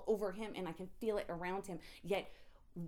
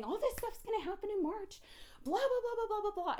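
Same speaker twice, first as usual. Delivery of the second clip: mock sing-song much higher; high mocking blah blah voice; 13 semitones higher, 3 dB louder than usual. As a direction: parody her own rambling in a high voice. Mocking her own prophecy talk.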